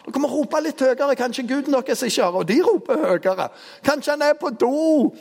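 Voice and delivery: high, teasing jeer at the crowd; mocking taunt. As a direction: needle them with a mocking lilt. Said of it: "han begynner jo å mobbe de tusen der".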